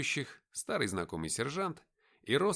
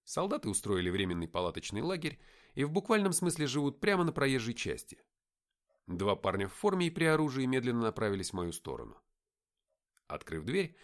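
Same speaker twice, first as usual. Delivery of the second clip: plain reading voice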